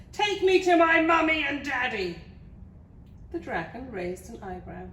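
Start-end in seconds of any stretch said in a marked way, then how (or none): 0.1-2.2 put-on high, loud childish demand; bellowed order. A narrator's reading. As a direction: bellow it like a bossy little princess. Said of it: "bellowed the little princess".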